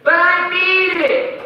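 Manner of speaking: put-on high, loud kid whine; whiny daughter. Preacher imitating daughter begging, high loud whine. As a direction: beg like a stubborn little girl. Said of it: "my daughter's like".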